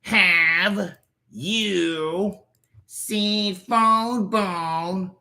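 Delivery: slowed right down, word by word. Acted. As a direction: drag each word out slowly. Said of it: "asked the question again slower".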